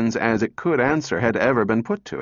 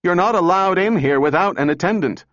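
shouting engineer; 7 semitones higher, 4 dB louder than usual. A narrator's reading. Engineer yells at crowd.

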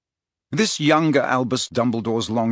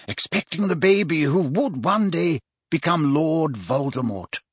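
higher-pitched, deliberate wizard voice; measured storytelling mentor; 5 semitones higher than usual. Acted. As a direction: recount the history like a learned mentor. Voices the wizard recounting history.

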